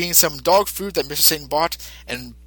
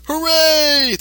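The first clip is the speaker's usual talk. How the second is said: cheer; 13 semitones higher than usual. Real, not acted. Dog healed, everyone rejoices.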